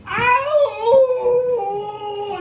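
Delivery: long, high, loud howl; sick dog howl. Acted. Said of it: "he was a sick dog".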